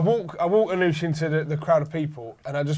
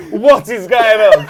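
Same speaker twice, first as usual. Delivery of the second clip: mock shocked; 3 semitones higher, 9 dB louder, slower than usual. Retold shocked fan reaction.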